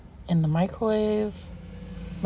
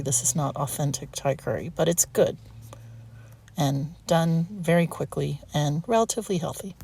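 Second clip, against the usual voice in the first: normal talking voice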